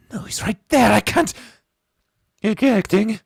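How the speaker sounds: high, loud desperate cries; desperate yell. Real, not acted. Player refusing to believe King's fate, desperate high yell. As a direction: shout in denial.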